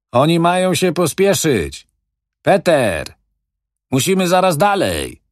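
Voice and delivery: loud, high call from the cab. A narrator's reading. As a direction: hurry the men along from the truck window. Driver's mate calling from cab.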